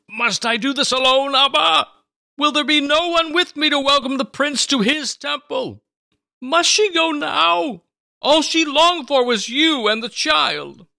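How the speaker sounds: high, loud sobbing plea to God; crying prayer. Acted. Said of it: "Simeon cried ... with great sobs".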